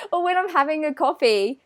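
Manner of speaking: ordinary delivery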